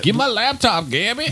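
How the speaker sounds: high mock shouting imitation of Kelly; mock whiny yell. Friend mimics Kelly yelling for his laptop, high mock whine.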